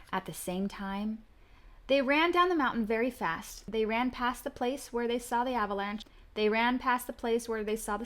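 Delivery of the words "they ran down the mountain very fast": ordinary delivery, nothing marked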